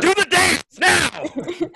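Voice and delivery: high, loud, slow yell; shouted command to dance; mock shout. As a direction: bark the order like a heckler.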